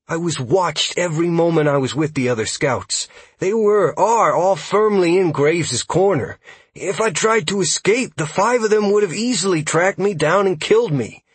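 high-pitched, defensive scout voice; worked-up, high character voice; distressed elf scout. Acted. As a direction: plead your case, frightened.

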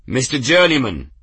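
loud summoning bellow. Acted. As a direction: call him out across the yard. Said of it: "Grim bellowed".

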